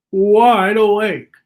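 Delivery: wide awake. Shown as a demonstration. Reader showing the child someone wide awake, slow and exaggerated. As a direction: stretch it out big and loud. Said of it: "I was doing my impression of wide awake".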